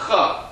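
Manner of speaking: Ch as a throaty rasp at the back of the mouth; the Hebrew letter sound Ch; guttural ch sound. Shown as a demonstration. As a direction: scrape out the Hebrew ch. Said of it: "that's usually the sound".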